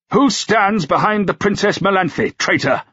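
high-pitched, accusing interrogation; demanding. A narrator's reading. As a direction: demand an answer from a traitor. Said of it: "Knollys demanded".

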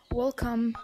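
usual speaking voice

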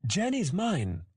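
put-on high childish indignation; possessive snap. A narrator's reading. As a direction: protest like an angry little one. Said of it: "Tori snapped".

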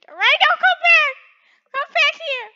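high, loud shout after someone fleeing; calling out. Pretend. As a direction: yell for your friend to come back.

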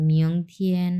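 "মিয়ং থিয়েন" with "mi-ong" gliding, held level; model pronunciation. Shown as a demonstration. Teacher telling students to copy, careful "মিয়ং থিয়েন" with an o glide. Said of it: "এইভাবে উচ্চারণ করতে হবে".

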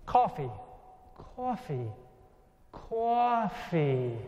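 student copying a New York coffee; repeating coffee in a New York accent; imitating New York accent. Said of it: "kind of the way I was saying it with my New York accent".

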